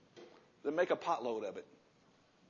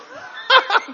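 loud laugh; 18 dB louder than usual. Real, not acted. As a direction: burst of high loud laughter. Laughs at his own joke.

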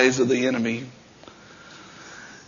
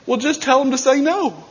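high-pitched mock quote of a first lady; Nancy Reagan; 12 semitones higher than usual. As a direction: lift the voice high, imitating her. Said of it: "It's like Nancy Proctor saying".

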